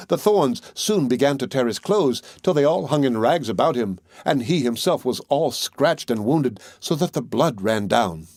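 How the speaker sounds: usual speaking voice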